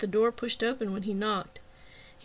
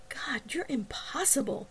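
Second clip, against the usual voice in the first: higher, exasperated whisper; 4 semitones higher, 4 dB quieter than usual. A narrator's reading. Woman scolding Wagner in the lobby, exasperated whisper.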